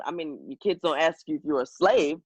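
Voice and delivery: ordinary talk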